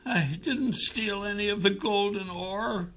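high, soft tearful pleading; sobbing. A narrator's reading. Young captive swears innocence to his father, sobbing. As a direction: plead in a small crying voice. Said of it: "The young man sobbed".

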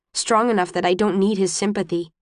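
usual speaking voice

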